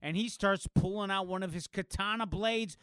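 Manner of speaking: normal talking voice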